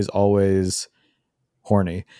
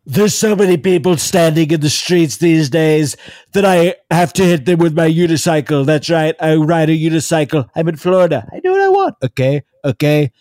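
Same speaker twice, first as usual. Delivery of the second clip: higher-pitched mimicry of a blustering politician; Donald Trump impression; 5 semitones higher, 3 dB louder than usual. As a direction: ramble boastfully in a raised imitation voice. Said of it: "do a Donald Trump impression".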